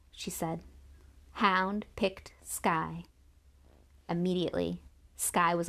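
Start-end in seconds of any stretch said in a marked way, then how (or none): 1.3-3.0 very slow, deliberate; slow announcement. Acted. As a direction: draw out each word. Jane announces slowly.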